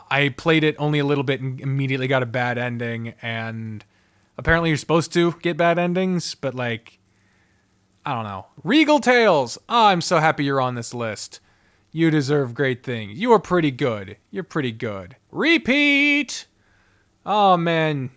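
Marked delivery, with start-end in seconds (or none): none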